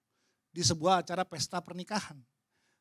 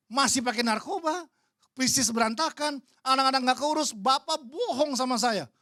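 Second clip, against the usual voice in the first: angry complaint; 5 semitones higher, 6 dB louder than usual. Retold or imitated. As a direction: pour out the grievance in anger. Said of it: "Dia marah". (keluhan marah, lebih tinggi dan keras)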